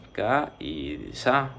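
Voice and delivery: "ka y sa" very slowly, syllables apart; dividido em sílabas. Shown as a demonstration. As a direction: split "ka y sa" into two drawn-out beats. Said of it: "La palabra se dividiría así".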